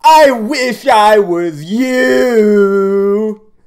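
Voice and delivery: slow, drawn-out sarcastic gushing; mock admiration. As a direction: stretch the words out in fake awe, especially the last one. Sarcastic reaction to the played clip.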